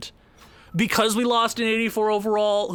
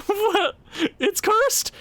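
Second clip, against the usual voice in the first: disbelief; 14 semitones higher, much slower than usual. Real, not acted. Disbelief at another loss.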